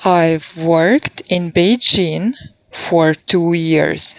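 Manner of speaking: I've said slowly and clearly, years falling; slow model sentence. Shown as a demonstration. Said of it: "So please repeat".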